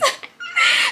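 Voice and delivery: shrill squeal of a laugh; high burst of laughter. Real, not acted. Laughing at memory.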